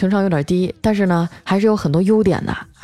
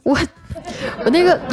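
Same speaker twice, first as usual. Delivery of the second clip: high, faltering excuse; hemming and hawing; 10 semitones higher than usual. Acted. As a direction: stammer like a brother caught out. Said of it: "在旁边支支吾吾地说". (支支吾吾，想辩解又说不出)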